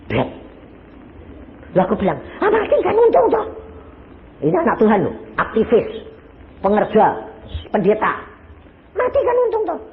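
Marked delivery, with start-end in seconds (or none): 2.4-3.4 high-pitched, offhand comeback; blithe retort. Retold or imitated. Retelling his teasing reply to friend. 9.0-9.8 high-pitched, teasing comeback; blithe retort. Retold or imitated. Repeats his teasing reply.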